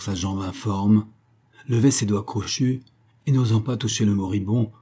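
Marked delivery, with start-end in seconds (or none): none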